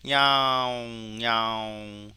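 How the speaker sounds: loud, long-held meow on a level pitch; cat meow imitation. Shown as a demonstration. Teacher recording cat sounds for training, imitated meowing. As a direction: stretch out each meow like a cat. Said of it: "고양이 사운드를 한번 우리가 저장해 보면".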